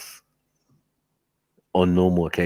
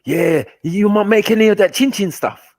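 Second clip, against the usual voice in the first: pleading friend; 11 semitones higher, 5 dB louder than usual. Retold or imitated. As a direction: whine it like a mate wanting snacks.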